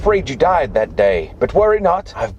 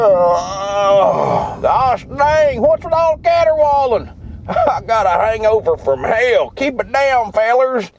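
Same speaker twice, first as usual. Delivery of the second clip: higher, twangy Southern yokel voice; 9 semitones higher, slower than usual. Acted. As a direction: play a hungover Southern good old boy. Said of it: "Why is he talking like that?".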